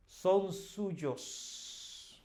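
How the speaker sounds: "Son suyos" pressed firmly, "Son" stressed and pitch up; emphatic. Shown as a demonstration. Teacher demonstrates an emphatic answer to students, firm stress on "Son". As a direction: insist on "Son suyos", leaning on "Son". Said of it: "That's emphatic there".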